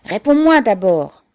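loud, angry demand; yelled order. A narrator's reading. Suitor holding the girl back, yelling an order. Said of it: "hurla-t-il".

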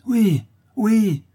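very high, loud cry; crowd outcry. A narrator's reading. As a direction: shout eager agreement as an angry mob. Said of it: "s'écriaient les laboureurs". (clameur de la foule)

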